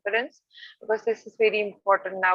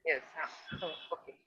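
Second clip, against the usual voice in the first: barely audible; 5 semitones lower, 14 dB quieter than usual. Real, not acted. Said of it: "it's audible".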